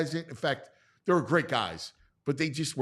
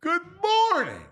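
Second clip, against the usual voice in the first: high sing-song greeting; 5 dB louder than usual. Real, not acted. Host greets Pat, sing-song.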